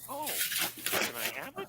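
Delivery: high, loud, eager alien imitation; cheerful alien. Alien asks narrator for the CD, high cheerful imitation. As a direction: pipe up brightly as the cheery alien. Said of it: "that's how he talks".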